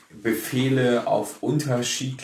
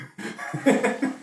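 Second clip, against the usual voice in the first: laughing; 13 semitones higher than usual. Real, not acted. Laughs at own feature request.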